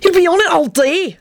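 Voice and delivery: high-pitched exasperated plea; desperate, high character voice. A narrator's reading. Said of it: "Benji said desperately".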